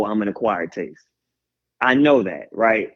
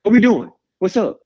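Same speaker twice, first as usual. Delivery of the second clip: eager wake-up hype; 7 semitones higher, 3 dB louder than usual. Retold or imitated.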